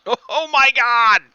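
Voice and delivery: loud, high burst of disbelief; astonished outburst. Real, not acted. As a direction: cry out at a shocking result. Reacts to big spell damage.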